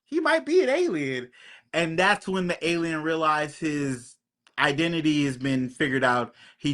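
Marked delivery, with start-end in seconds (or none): 0.0-1.3 much higher, sudden dawning alarm; a gasp of "Oh shit", voice jumping up; shocked realization. Retold or imitated. Sheriff watching the interrogation realizes the man is an alien, high shocked.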